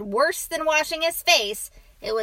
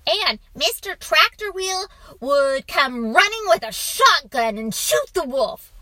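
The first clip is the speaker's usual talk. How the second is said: loud, high eager build-up; excited storytelling; 6 semitones higher, 8 dB louder than usual. A narrator's reading. Boy adding a shotgun to dad's tale, excited. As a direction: pile on the drama like an excited child.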